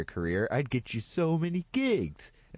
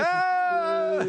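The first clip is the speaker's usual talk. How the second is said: exasperated shout; 3 dB louder than usual. Real, not acted. Impatient outburst over joke.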